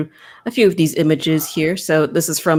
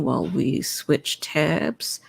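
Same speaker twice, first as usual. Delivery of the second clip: slow, hushed aside to the audience; playful whisper; 7 dB quieter, much slower than usual. Pretend. Host pausing the show to switch tabs, in a slow playful whisper. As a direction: drop to a slow stage whisper as if backstage.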